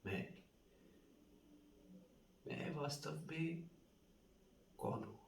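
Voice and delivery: pondering self-questioning. Acted. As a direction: ask it softly, feeling for each word. Actor ponders who he really is.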